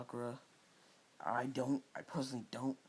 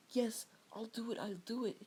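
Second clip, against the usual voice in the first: put-on much higher, eager girlish yes; eager Sakura; 11 semitones higher than usual. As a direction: jump at the offer.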